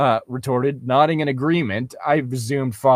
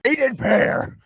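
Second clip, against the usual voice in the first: mocking; 11 semitones higher than usual. Mocking the cheesy fanfic phrase.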